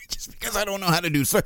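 amused, breaking off mid-word; laughing. Real, not acted. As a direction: push the words out while still laughing. Still laughing at his own argument.

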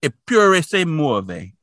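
slow, careful Italian "Eppure se muove"; Italian pronunciation. Shown as a demonstration. Reading the Italian tagline for the podcast, slow careful Italian. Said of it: "my pronunciation is better".

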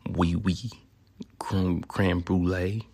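bad French words, drawn out; mock French accent. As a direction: botch a French accent on purpose. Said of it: "Can't say that shit correctly".

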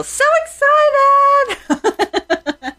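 very high, slow, squealing So excited; hammed-up excited squeal, drawn out; official excited voice. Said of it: "Let me do my official".

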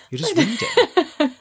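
high, loud, slow, laughing disbelief. Real, not acted. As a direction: crack up while saying it. Laughing at the boring story.

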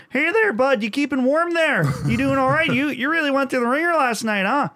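sweet on the surface, needling underneath; mock polite Canadian. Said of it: "sounds like they're being really nice".

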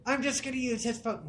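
a light mock of a pushy stranger; mimicking the lady. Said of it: "She's like".

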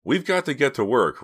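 loud, high, stern order. A narrator's reading. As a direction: call staff to work sternly. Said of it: "Rawson said sternly".

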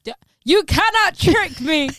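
high, loud teasing protest; playful. Real, not acted. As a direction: call her bluff, laughing. Playful accusation between friends.

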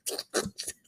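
soft, breathy chuckle at oneself; real giggle. Real, not acted. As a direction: let a quiet laugh slip out. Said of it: "I gotta stop laughing at myself".

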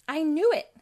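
loud, high exclamation; excited outburst. Acted. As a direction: blurt it out triumphantly. Sonic's sudden realisation.

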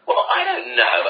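defensive shrug. Retold or imitated. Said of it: "And you're like".

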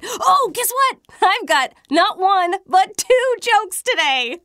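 high, loud and eager; bursting with news; excited. Acted. Excited announcing two jokes.

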